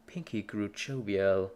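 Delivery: usual speaking voice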